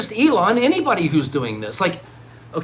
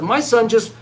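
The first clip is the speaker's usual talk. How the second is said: proud parent shout; 8 semitones higher, 3 dB louder, slower than usual. Retold or imitated.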